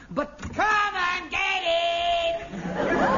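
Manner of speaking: long, high drawn-out holler; bellowed call. Acted. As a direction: stretch it out into a big high shout. Describing the part, suddenly bellows out.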